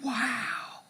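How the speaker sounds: whispered wow; soft whispered wonder; awed whisper. Retold or imitated. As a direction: breathe it in awe. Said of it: "And I thought".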